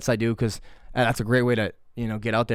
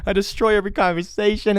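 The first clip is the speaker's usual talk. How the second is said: ashamed admission; 7 semitones higher than usual. Retold or imitated. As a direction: wail your fault in mock despair. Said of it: "that was shameful".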